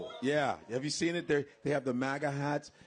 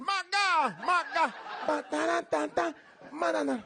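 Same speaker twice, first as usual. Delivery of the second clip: mock Spanish accent; 13 semitones higher than usual. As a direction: ham up a fake Spanish accent. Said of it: "I can almost sound Spanish".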